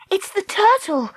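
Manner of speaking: high cry of sudden realisation; exclaiming. A narrator's reading. Dakota works out why the eels attack, exclaiming. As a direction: exclaim it high, the answer just struck you. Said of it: "Dakota exclaimed".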